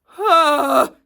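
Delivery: big exasperated sigh; heavy sigh into the mic; loud sigh. Real, not acted.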